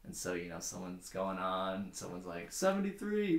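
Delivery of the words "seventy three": high-pitched call of a number. Acted. Joke teller as someone calling a joke number, high call. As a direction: sing it out high, like an inmate calling.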